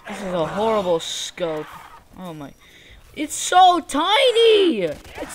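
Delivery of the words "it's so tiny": loud, high, drawn-out complaint; exasperated outcry. Real, not acted. Gamer griping about a tiny scope, exasperated outcry. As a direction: cry out in disbelief, stretching the words.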